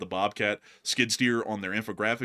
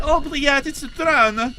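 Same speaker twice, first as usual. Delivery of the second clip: high, loud mock alarm; hammed-up cry of alarm; mock panicked Russian soldier; 13 semitones higher, 8 dB louder, slower than usual. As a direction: play a soldier spotting a drone, loud and high. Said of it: "The Russian's like".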